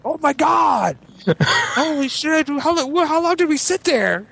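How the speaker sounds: high, frantic alarmed cry; shrieking oh my God panic; panicked shout. Retold or imitated. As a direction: yell in sudden horror at the hot car. Panicked discovery of overheated kid.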